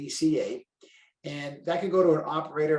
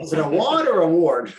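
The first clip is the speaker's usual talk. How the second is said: mock puzzled listener; 3 semitones higher, 9 dB louder than usual. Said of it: "I feel like you're gonna say".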